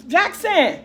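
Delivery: calling out to her son. Real, not acted. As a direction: call his name across the house. Calling son from another room.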